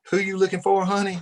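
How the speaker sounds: flirty bartender. Retold or imitated. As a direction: purr the question at the newcomer. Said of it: "And the guy says".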